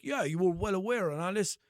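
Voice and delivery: lightly mocking the agent's dismissive tone; passive aggressive. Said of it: "getting pure fucking passive aggressive".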